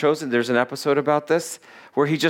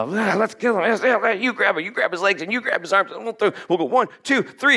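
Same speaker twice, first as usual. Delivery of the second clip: higher, rushed mock mob clamour; frenzied mob; 6 semitones higher, faster than usual. Mob plotting to throw him off the cliff, frenzied. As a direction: act out a worked-up mob egging each other on.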